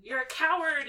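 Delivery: acted outrage, half-yelled; Harry yelling. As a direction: throw the insult after them. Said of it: "he's yelling after him".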